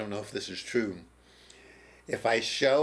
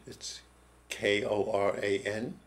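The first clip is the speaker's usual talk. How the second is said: normal talking voice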